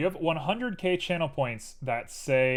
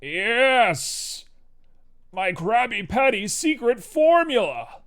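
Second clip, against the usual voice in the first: loud, high-pitched cartoon villain accent; Plankton; 10 semitones higher, 7 dB louder, slower than usual. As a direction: shout it like a scheming little cartoon villain. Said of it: "I feel like Plankton".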